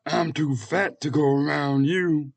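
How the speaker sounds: loud, high, drawn-out fat man voice; fat man character. Acted. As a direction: boom it out slowly as the fat man.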